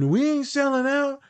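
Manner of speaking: high, slow mocking impression of a defiant student. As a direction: mimic the students' stubborn insistence, drawn out and high. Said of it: "And they said".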